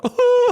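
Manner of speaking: very high, loud yelp of pain; pained cry. Retold or imitated. He retells the pain of his broken jaw, high loud yelp. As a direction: cry out like your jaw just hit you.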